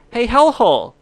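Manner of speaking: loud, high hail down into the depths; calling out. Pretend. Host shouting down to the hellhole, loud high call. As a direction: yell down the pit, high and loud.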